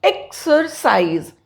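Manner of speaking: एक्सरसाइज said slowly, the X as KS; teacher's model word. Shown as a demonstration. Teacher spells out the X in exercise, said slowly. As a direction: draw out एक्सरसाइज with a clear KS sound. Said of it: "केएस".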